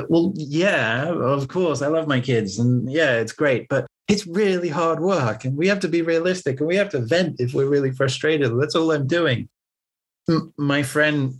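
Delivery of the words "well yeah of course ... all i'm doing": defensive. Retold or imitated. Retold defensive reply.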